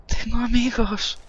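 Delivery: squeaky indignant voice. Pretend. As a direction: drag it out, high and offended. Insisting to the game he has friends.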